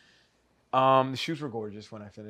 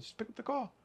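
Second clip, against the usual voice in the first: a much higher mom voice, softer; imitating mom; 10 semitones higher, 9 dB quieter than usual. Mom urging her nervous son in the car, high mock voice. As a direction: pitch way up to play his mom.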